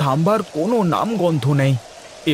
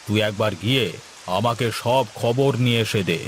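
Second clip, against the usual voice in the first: lower, heavier landlord voice; zamindar voice; 7 semitones lower, 3 dB quieter than usual. Acted. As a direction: speak down as the village landlord. Same actor voices the zamindar.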